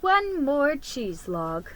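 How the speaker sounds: plain reading voice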